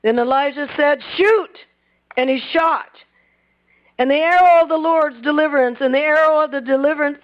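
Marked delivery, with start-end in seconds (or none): 1.2-1.6 sharp, higher barked command to shoot; commanding shout. Retold or imitated. Prophet ordering the king to fire, sharp shout. 4.0-7.2 higher, louder proclaiming of the arrow; preaching proclamation. Acted. Preacher to congregation, proclaiming the arrow.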